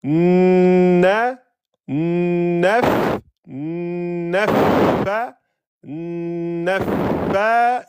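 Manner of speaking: long humming n, then a held hissing f in "nafa"; building up the shadda. Shown as a demonstration. Teacher breaking down "nafa" for students, every sound held long. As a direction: build "nafa" piece by piece, lingering on n and doubled f. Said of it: "et là vous avez mis tout".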